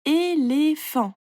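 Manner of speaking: "Éléphant" slowly as é, lé, phant; "Éléphant" in three slow, clear beats; syllable by syllable. A narrator's reading. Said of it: "tu coupes le mot en petites parties".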